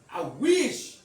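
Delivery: loud, high drawn-out wish; retold exclamation. Speaker scolding Sweet, loud and emphatic. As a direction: punch it out loudly with feeling.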